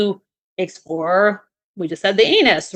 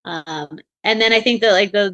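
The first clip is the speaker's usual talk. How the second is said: ordinary talk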